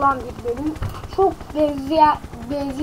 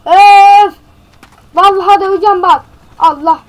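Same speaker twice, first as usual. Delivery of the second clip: loud, higher exasperated threat; frustrated outburst; 6 semitones higher, 14 dB louder than usual. Real, not acted. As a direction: vent your rage at the game. (sinirden patlayan yüksek sesli tehdit)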